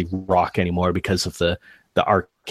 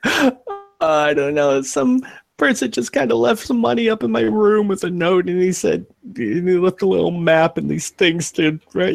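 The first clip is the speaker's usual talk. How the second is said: high, frightened rambling; panicked explaining; 10 semitones higher than usual. Acted. Scared worker tells the intruder who hired him, panicked. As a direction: babble excuses in a high scared voice.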